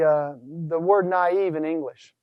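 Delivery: ordinary talk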